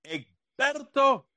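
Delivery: high, slow, drawn-out call of his name; mock eager caller. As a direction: sing out the name like an eager friend. Voices Arnie calling him.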